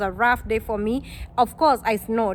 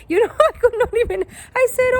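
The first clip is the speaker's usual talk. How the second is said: laughing; 10 semitones higher, 4 dB louder, faster than usual. Real, not acted. Laughing at her own confusion.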